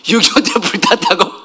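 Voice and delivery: put-on amused, suspicious child questioning the story; disbelieving echo. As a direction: repeat it as if you don't buy it. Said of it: "거짓말 같은 거야".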